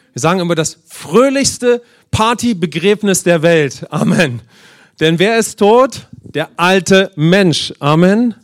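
loud, rising preacher's call; booming sermon voice with drawn-out words; fiery preaching. Acted. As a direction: preach it out to the congregation. Preaching climax, congregation call.

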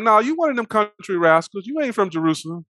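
high, teasing folksy accusation; mock accusation. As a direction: imitate bystanders calling out an outsider. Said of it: "But they like".

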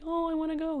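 high, lightly voiced husband; mock husband voice. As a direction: imitate him whining a little. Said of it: "he was like".

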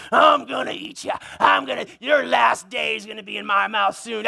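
growling mock-menacing dog voice; ferocious dog. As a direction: threaten like a rabid dog at the fence. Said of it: "it would be something like this".

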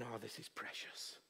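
soft breathy whisper to a newborn; hushed tender whisper; cooing whisper. Retold or imitated. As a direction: whisper it like a mother over her baby. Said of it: "she's going".

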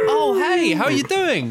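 hammy squeaky welcome; high, bright put-on voice; high-pitched character greeting. Greeting returning guest in character.

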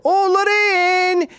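very high, loud, stretched-out taunt; mocking dad. Dad to son after a timid pool shot, loud high taunting. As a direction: hold the name out in exaggerated mockery. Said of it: "my dad would go".

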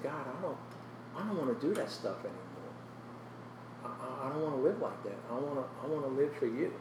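soft, earnest plea to God; hushed prayer. Retold or imitated. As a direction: pray it quietly and sincerely. Retold heartfelt prayer to God.

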